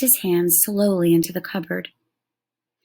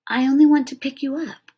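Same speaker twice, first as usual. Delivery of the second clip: put-on higher boy's voice, coaxing; boy pleading to pick up tiny Indian; 7 semitones higher, 3 dB louder than usual. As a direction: gently coax a wild little creature.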